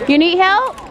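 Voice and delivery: high, loud helpful call; calling out an offer. Real, not acted. Onlooker offering help to a little skier, calling out. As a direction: call it across, pitched right up.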